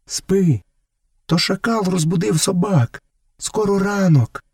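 put-on high-pitched mother settling her child; narrator's high woman's voice; woman calling over her shoulder. A narrator's reading. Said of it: "жінка озвалася через плече".

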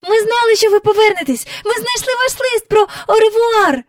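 високо, гучно й швидко; гукни радісно й поспіхом; радісно гукала. A narrator's reading. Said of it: "гукала Джейн". (Джейн радісно гукає)

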